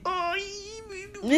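very high, soft whimper of pain; teasing imitation of her complaining; mock whimpering. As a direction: whine it like a hurt child. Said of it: "Estaba así".

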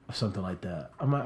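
ordinary delivery

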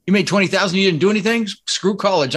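half-acted disbelief at mom's profit; incredulous disbelief. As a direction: replay your stunned teenage reaction. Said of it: "I'm like".

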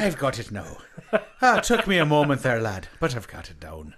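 put-on low, comic Irish accent. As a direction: settle into a low stage Irish. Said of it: "in your Irish accent".

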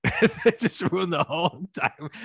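words squeezed out through laughter; laughing. Real, not acted. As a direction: laugh as you complain. Laughing while complaining about movie.

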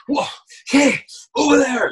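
exertion call. Shown as a demonstration. Actor showing ADR exertion, high loud call.

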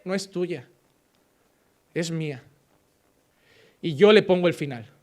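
low, soft, grave voice of God answering. Acted. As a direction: speak as God, quiet and heavy with authority. God answering a despairing believer. (voz de Dios, grave y baja)

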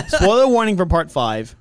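loud, high announcement; called-out warning. Real, not acted. Host warning listeners about part five, shouted announcement. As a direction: call it out over the room.

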